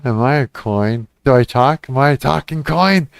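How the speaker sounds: ordinary talk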